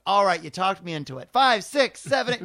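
loud, high count-in for a dance number; eager dance count-in. Retold or imitated. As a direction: belt out the count like a showgirl. Lisa launching into dance number.

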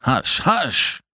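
flustered shushing; alarmed exclamation. A narrator's reading. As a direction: exclaim it to silence him at once. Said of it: "exclaimed the doctor".

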